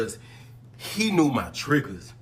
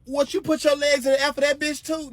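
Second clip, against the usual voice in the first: accusing retort; 12 semitones higher than usual. Retold or imitated. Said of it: "I'm like".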